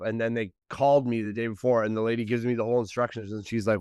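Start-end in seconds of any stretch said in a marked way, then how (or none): none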